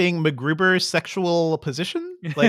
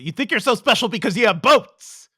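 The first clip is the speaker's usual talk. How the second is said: higher-pitched, wavering meme imitation; mock movie-line quote; 4 semitones higher than usual. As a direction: parody a petulant outburst from a film. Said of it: "It's the boats line, which people have been memeing out".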